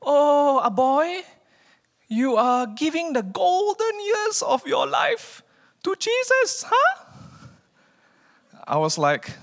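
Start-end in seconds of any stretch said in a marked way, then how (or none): 0.0-7.1 much higher, slow, sing-song auntie voice; nosy church auntie. Retold or imitated. Church aunties tease young man giving years to Jesus, high sing-song. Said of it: "some aunties in my church, classic, were like".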